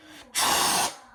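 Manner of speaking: loud hissing spray sound; loud spray noise. Shown as a demonstration. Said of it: "I'll do one more time louder".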